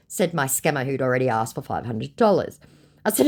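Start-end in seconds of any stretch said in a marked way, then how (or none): none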